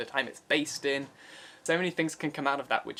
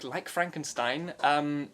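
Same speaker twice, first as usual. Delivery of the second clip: usual speaking voice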